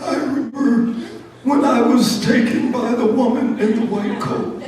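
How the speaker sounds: put-on high-pitched old mouse recounting horrors; old Mouse Johnson. Old mouse telling the others horrible stories, high-voiced dread. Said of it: "Like old Mouse Johnson".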